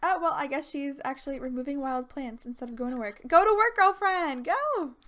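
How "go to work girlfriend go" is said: louder, higher playful shout; calling out. Real, not acted. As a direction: urge her off to work. Yelling at Sim to go.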